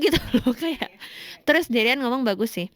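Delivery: ordinary talk, nothing marked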